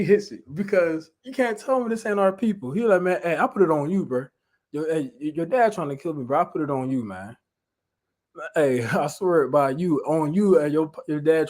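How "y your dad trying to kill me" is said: ordinary talk, nothing marked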